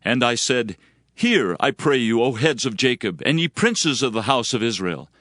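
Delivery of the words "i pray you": normal talking voice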